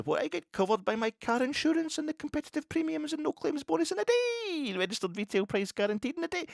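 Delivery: hammed-up Welsh advert voice; mock Welsh. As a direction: parody the sponsor plug in his voice. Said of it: "I can't do the Welsh accent".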